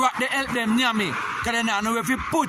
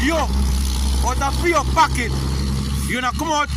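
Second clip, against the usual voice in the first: louder and higher, each word dragged out slow; angry slow accusation; 6 semitones higher, 4 dB louder, slower than usual. Real, not acted. Ranting at the politician over withheld donations, slow angry accusation. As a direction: jab the words at him, slow and heated.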